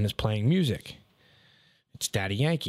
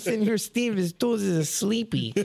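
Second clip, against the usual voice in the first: high, accented imitation of coworker; imitating the coworker's accent, high; Guatemalan coworker; 10 semitones higher than usual. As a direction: deliver it in his broken English. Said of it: "he's, he's".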